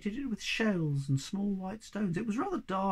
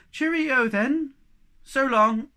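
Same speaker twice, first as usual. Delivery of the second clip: a bright called farewell, higher and louder; calling goodbye; 9 semitones higher, 10 dB louder, slower than usual. Acted. Friends parting on the bridge, called cheerfully. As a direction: call it across the bridge.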